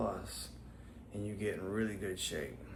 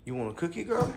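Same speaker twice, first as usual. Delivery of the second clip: talking to a dog; 8 semitones higher, 4 dB louder than usual. Real, not acted. Talking to his dog Molly.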